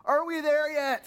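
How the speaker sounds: high, slow whiny question; impatient kid. Retold or imitated. Pastor mimicking a kid in the back seat, slow and whiny. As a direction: drag it out like a restless child. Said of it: "it's been this".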